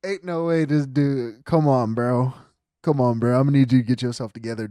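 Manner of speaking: loud come-on-bro scold; loud fed-up plea; exasperated scolding. Real, not acted. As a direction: tell him to pull it together. Scolding the wailing client.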